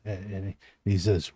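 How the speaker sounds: ordinary delivery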